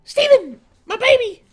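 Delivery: very high, very loud cries; Pearl. Acted. Streamer playing Pearl calling Steven, high shrieking. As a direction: shriek out short doting calls. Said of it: "Here's my pearl".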